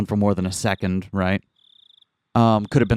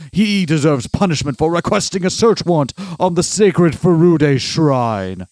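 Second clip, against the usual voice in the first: a lightly voiced, domineering old man; intense old man voice; 5 semitones higher, 5 dB louder than usual. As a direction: talk down like a haughty old man. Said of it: "I'm doing an old man voice, but very intense".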